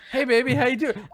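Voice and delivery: high-pitched put-on come-on; mock flirtation. Suitor to the elf he smooches, mock flirting. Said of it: "Like, you're like".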